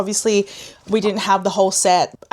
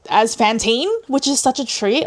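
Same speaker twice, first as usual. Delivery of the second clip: ordinary delivery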